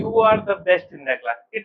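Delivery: "You are the best in the class" drawn out very slowly; slow example. Shown as a demonstration. Teacher dictates a superlative example very slowly. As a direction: read each word as if dictating. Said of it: "और फिर मैंने कहा कि".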